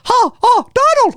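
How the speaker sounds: very high squeaky cartoon mouse laugh; Mickey Mouse voice. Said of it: "is not an impression of Mickey Mouse".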